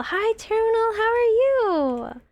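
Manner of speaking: high, slow, sing-song hello; sweet greeting. Real, not acted. Streamer greeting a viewer, high sing-song hello. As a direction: greet a friend brightly.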